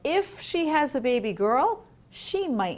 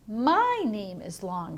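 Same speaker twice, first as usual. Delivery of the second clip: My drawn out and punched at the start; stress on My; slower than usual. Shown as a demonstration. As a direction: hit My hard, then ease off. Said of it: "put some stress on that word".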